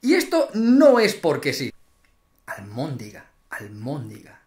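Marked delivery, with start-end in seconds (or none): 2.4-4.4 "Almóndiga" whispered much softer and lower; mock sorrow. Vlogger grieving over an accepted word, low and soft. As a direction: mourn the word "almóndiga" quietly. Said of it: "mourning the accepted word".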